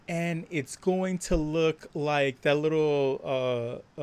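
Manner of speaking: ordinary delivery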